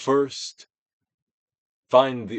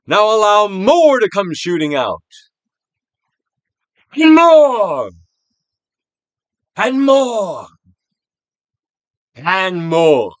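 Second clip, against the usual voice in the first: building urging call; 8 semitones higher, 12 dB louder, slower than usual. Shown as a demonstration. Urging the energy to shoot out.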